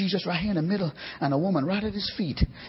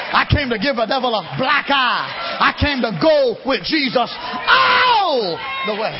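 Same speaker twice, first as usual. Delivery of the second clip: louder and louder preached cadence; building preaching; 4 semitones higher, 5 dB louder than usual. Acted. Preacher firing up congregation, loud building shouted preaching. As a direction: drive it home to the church.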